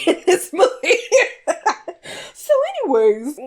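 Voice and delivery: giggling, voice jumping up high; laughing. Real, not acted. Swooning over Idris Elba on screen, laughing. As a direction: crack up over how good he looks.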